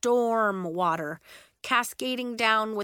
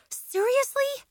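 incredulous; 3 dB louder than usual. A narrator's reading. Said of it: "Nori said".

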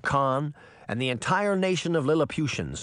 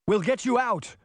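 much higher urgent call; cried; 10 semitones higher than usual. A narrator's reading. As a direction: promise it to her through the barrier. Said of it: "Mordred cried".

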